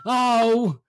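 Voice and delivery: high, slow, hammed-up moan; moaning mother. Mother rocking on the bench, moaning. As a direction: imitate a mother wailing in distress.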